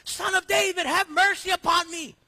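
high, loud cry for mercy; begging shout of blind men; pleading yell. Retold or imitated. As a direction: call out desperately from far off. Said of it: "yelling from the top of their lungs".

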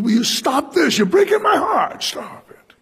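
high strained cry to stop, breaking at the end; heartbroken, begging them to stop; pained plea. Retold or imitated. Preacher voicing Paul pleading with weeping friends, high strained cry. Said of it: "And he goes".

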